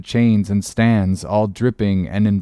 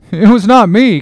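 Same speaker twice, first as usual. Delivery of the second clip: high, loud panicked denial; frightened cry; 10 semitones higher, 9 dB louder than usual. Acted. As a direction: protest in panic. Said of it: "cried Doughboy".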